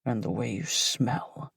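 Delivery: low, soft man's murmur; low male voice. Acted. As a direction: say it as the man, hushed and low. Narrator voices lover.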